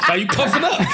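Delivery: laughing high-pitched tease. Real, not acted. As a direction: giggle through the question. Teasing a friend, laughing.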